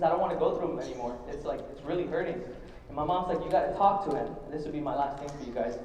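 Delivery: holding back tears. Real, not acted. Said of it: "I'm gonna try not to cry again".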